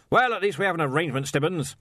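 loud, high, blustering archchancellor; blustery old wizard. Acted. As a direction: demand it like a bluff old master.

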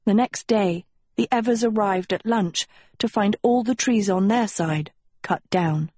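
ordinary delivery

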